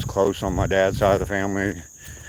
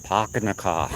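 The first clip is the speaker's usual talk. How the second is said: r-less Boston accent imitation. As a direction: mimic a Bostonian, "pahk the cah". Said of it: "someone from Boston talk".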